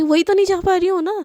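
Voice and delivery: put-on upset girl complaining she can't go; frustrated wail. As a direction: blurt out the frustration. Divya frustrated reply.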